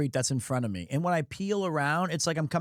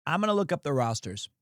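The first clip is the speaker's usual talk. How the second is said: ordinary delivery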